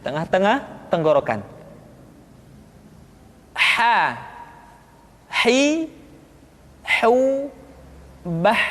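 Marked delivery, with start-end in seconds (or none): 3.8-8.6 "Ha, hi, hu, bah" each apart, breathy h from mid-throat; Arabic letter ha demo. Shown as a demonstration. Teacher showing the mid-throat letter, ha demo. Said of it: "Kesalahan yang paling sering ketika mengucapkan huruf H".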